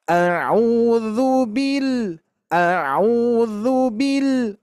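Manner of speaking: bil ditekan naik lalu turun panjang; stress on bil. Shown as a demonstration. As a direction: tekan suku kata "bil" lalu tahan. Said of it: "Penekanannya di sini". (tekanan pada "bil", dibaca pelan)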